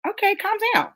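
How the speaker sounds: high, weary brush-off; dismissive calm down. Retold or imitated. People telling the ranting girl to calm down, dismissively. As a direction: roll your eyes and wave her off. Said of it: "So people are like".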